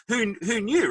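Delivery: ordinary delivery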